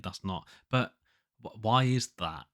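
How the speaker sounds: normal talking voice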